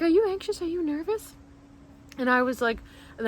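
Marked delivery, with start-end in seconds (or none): 0.0-1.3 high-pitched imitation of gentle questioning; high voice checking on a nervous patient; concerned nurse. Nurse to patient covered in rash, high concerned imitation.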